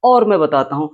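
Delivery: ordinary delivery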